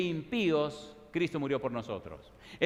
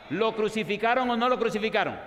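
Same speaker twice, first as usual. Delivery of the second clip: louder, higher, pounding pulpit preaching; fiery sermon climax; preaching at full force; 5 semitones higher, 5 dB louder than usual. Acted. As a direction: drive it home to the whole church.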